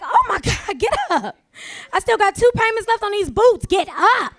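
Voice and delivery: loud frantic plea to get up; panicked yelling. Pretend. To collapsed date over unpaid boots, panicked yelling. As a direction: yell at him to get up, panicking.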